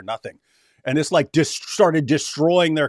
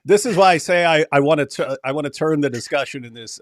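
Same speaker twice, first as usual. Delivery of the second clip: normal talking voice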